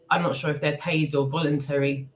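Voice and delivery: ordinary delivery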